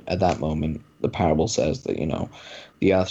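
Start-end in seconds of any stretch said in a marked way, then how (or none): none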